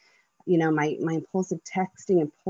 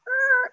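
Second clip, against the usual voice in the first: startled squeak; 4 dB louder than usual. Retold or imitated. Mother stunned by son's words.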